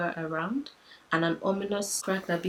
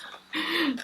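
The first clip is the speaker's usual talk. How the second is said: high laugh; 10 semitones higher than usual. Real, not acted. Laughs at herself.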